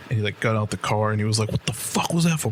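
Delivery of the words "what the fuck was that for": imitating dad's indignant demand, pitched up; a retold, mildly heated challenge; angry retort. Dad confronting the fan who punched the window, mock angry. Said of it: "he was just fucking pissed".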